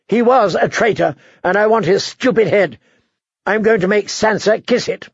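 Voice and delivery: put-on a spoiled young tyrant insisting; petulant boy king. A narrator's reading. Joffrey voiced, cruel threats.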